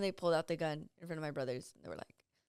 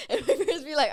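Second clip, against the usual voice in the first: normal talking voice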